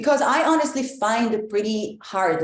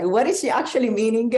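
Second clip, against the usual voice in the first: confused listener; 3 semitones higher, 3 dB louder, faster than usual. Retold or imitated. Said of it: "leave people a little like".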